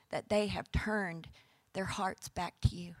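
hushed, breathy awe; whisper. Real, not acted. Preacher whispering the prayer's close to the congregation. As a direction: let the words fall to a whisper.

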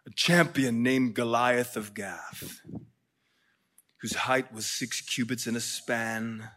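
put-on hushed, low British-accented scripture reading; quiet British storyteller reading scripture. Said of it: "read it in a British accent".

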